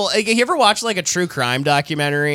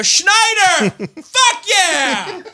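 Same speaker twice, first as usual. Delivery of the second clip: excited shout; 12 semitones higher, much slower than usual. Retold or imitated. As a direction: yell it from the car window. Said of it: "I rolled the window down and I go".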